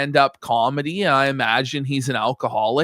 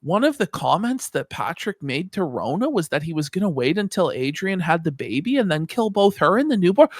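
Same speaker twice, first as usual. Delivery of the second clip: normal talking voice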